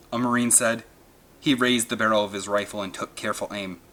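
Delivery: ordinary delivery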